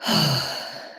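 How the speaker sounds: long, falling sigh; sigh of relaxation. Shown as a demonstration. Showing a listener how to let the sound flow, relaxed sigh. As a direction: let out a relaxed, audible exhale. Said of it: "let out a sigh of relaxation".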